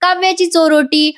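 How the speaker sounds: high-pitched mild yell; imitated excited shout; mock shout. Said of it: "मोठ्याने ओरडतात".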